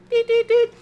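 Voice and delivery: high loud imitated beeping; mock microwave beeps. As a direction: chirp it like a microwave alarm.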